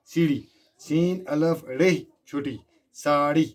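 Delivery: put-on teacher calling out अड़े, सीढ़ी, साड़ी for children to repeat, every ड़ sharp; clear pronunciation drill. Shown as a demonstration. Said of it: "इसकी जो pronunciation है". (ड़ वाले शब्द ज़ोर से, साफ़ उच्चारण में)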